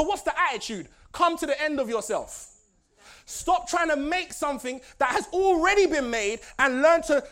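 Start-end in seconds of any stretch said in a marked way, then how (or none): 3.3-7.2 preaching. Acted. Preaching to the congregation.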